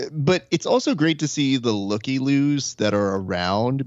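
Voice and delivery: normal talking voice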